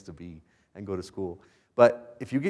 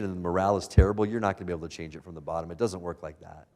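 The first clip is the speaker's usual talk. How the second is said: ordinary talk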